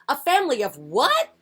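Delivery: high, loud disbelief; astonished rise on the last word; incredulous question. Acted. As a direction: ask as if you can't believe it. Headquarters reacts in disbelief.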